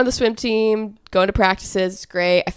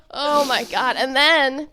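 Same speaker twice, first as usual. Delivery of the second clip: usual speaking voice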